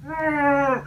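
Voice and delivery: sudden high strained grunt; mock strain grunt. Shown as a demonstration. Coach shows clients the wrong way: high strained grunt. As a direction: demo of straining too hard. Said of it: "you don't wanna go".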